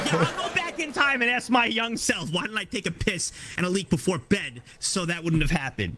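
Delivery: high-pitched, exasperated self-defence; worked-up, high-pitched excuse; defensive protest. Real, not acted. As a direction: protest indignantly in a squeaky high voice. Defensive reply to bed-wetting question.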